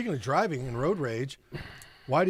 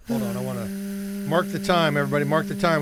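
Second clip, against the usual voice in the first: mock announcement; 4 semitones higher, 4 dB louder, faster than usual. Announcing a de-escalation to all.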